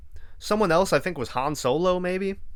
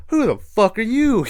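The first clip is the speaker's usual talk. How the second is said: higher, louder retold outburst; mock indignant challenge; 9 semitones higher, 6 dB louder than usual. Chanters turn on the friends who joined in, mock indignant. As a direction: act out a startled, affronted demand.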